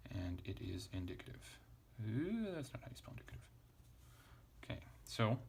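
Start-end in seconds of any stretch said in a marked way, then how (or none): 2.0-3.4 surprised ooh. Real, not acted. Teacher notices a spelling slip.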